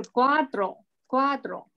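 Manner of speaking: cuatro said slowly, the tr kept together after the a; teacher shows a student the number four; slow careful cuatro. Shown as a demonstration. Slow model of cuatro. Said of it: "不一样的啊".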